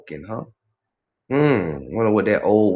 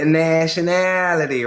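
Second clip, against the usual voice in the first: put-on real Southern accent; 6 semitones higher, 4 dB louder than usual. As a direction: lean into the Southern vowels, higher. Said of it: "Real Southern".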